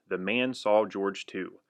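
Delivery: plain reading voice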